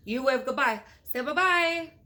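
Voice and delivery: ordinary delivery, nothing marked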